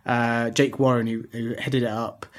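usual speaking voice

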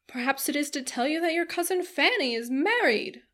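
high, swooping busybody matron voice; teasing, insistent older-lady character; prying matron. A narrator's reading. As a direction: press for gossip in a lilting, nosy voice. Voices prying Mrs Jennings.

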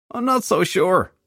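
high, drawn-out, doubtful complaint; self-pitying invalid. A narrator's reading. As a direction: drag it out like a suffering patient. Hungover detective doubts he will live.

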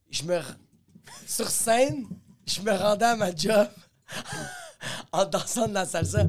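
breaking up mid-sentence; can't hold the laugh in. Real, not acted. Cracking up telling old bit.

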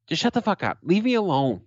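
high-pitched, fed-up dismissal; exasperated retort. Acted. Comic talking back to people who police cursing, exasperated. Imagined retort to language critics.

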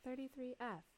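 calm cabin-crew voice calling seat numbers; flight attendant. Acted. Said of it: "you sounded like a flight attendant".

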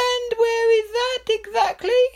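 high, far-off anxious royal voice; nervous king. Acted. King fearing the drapes near the palace, high and nervous. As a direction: ask it with nervous worry. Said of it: "asked the king nervously".

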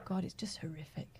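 hushed whisper of horror. Real, not acted. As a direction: breathe it out, appalled. Recalling a harrowing film.

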